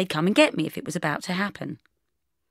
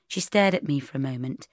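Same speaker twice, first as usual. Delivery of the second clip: ordinary delivery, nothing marked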